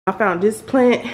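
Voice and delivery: normal talking voice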